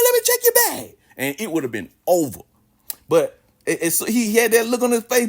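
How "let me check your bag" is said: high-pitched imitation of a teacher's order; hammed-up squawking command; mock stern teacher. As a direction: demand a bag search, pitched way up. Imagined teacher demanding a bag search.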